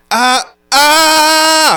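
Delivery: very high, held distorted "uh"; forced vocal distortion. Shown as a demonstration. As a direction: push lots of air into a high, distorted cry. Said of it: "forced distortion is where basically".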